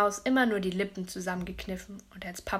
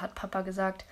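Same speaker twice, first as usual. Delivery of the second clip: ordinary delivery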